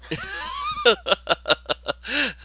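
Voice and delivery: high, shrieking laughter; laughing hard. Real, not acted. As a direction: laugh till it squeaks. Family shot ceiling.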